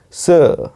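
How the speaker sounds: सर as long "suh", no R; correct pronunciation. Shown as a demonstration. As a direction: draw out सर as "səə", R silent. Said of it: "इसका जो correct उच्चारण".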